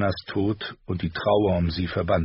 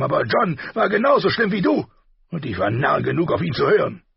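loud, raised bitter cursing; angry king; 8 semitones higher, 4 dB louder than usual. A narrator's reading. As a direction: curse it out in frustration. Robert voiced in audiobook.

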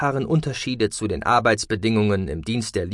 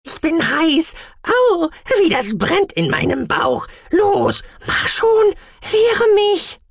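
sehr hoch, laut, quengelnd; verzerrte Kannenstimme; 5 dB louder, slower than usual. Acted. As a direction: ein alter Kessel, der drängelt. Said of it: "dass ihre Stimmauskabe verzerrt klang". (hohe, verzerrte, drängelnde Kannenstimme)

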